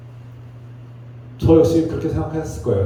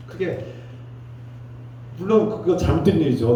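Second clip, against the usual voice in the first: usual speaking voice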